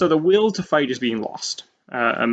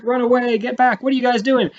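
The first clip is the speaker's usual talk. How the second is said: higher and louder, urging others to flee; panicked French retreat; 11 semitones higher, 5 dB louder than usual. Retold or imitated. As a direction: yell back at the Marines like fleeing soldiers. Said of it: "and they're like".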